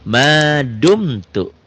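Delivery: drawn-out "Madumtu"; slow recitation. Shown as a demonstration. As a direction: stretch it slowly. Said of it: "Apa hukumnya?".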